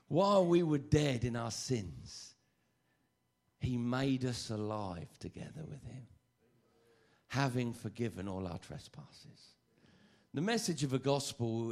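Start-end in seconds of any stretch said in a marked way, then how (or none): 0.1-9.5 slow, emphatic verse reading; measured, declaimed scripture; scripture recitation. Real, not acted. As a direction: proclaim the verse with weight, drawn out.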